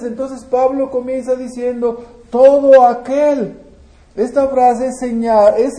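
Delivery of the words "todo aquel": slow, louder, weight on every word; emphatic quote. Acted. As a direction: lean hard on Todo aquel. Preacher quoting Paul's key phrase.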